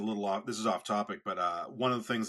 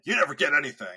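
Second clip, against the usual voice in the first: louder, higher mock exasperation; mock exasperated jab; 6 semitones higher, 10 dB louder, faster than usual. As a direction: jab like a fed-up friend. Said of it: "he's going to just be like".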